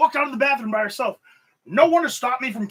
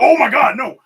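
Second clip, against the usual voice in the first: loud, higher burst of sudden panic; alarmed gasp; 5 semitones higher, 10 dB louder than usual. Retold or imitated. Catching himself before a kick, alarmed cry. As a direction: yelp as if about to kick something fragile. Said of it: "'cause you're like".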